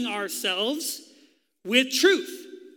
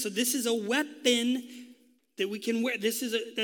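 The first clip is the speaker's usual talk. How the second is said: normal talking voice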